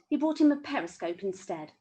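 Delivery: ordinary delivery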